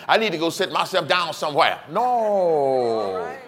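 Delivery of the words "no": emphatic no. Real, not acted. Emphatic denial.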